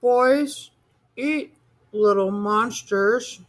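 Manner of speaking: high, slow little monster voice; a monster telling his fear; scared child. A narrator's reading.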